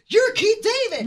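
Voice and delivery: loud, high starstruck outburst; excited blurt. Retold or imitated. Fan recognizing Keith David at work, excited blurt.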